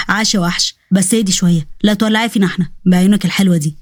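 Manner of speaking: low, teasing man's voice; Osman, playful flirt. Acted. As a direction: cheer her on with gentle teasing.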